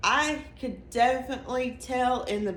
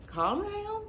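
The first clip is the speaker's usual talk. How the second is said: high, soft mock reassurance; lightly imitating her own hushing; retold soothing; 12 semitones higher, 4 dB quieter than usual. Wife calms husband before surprise.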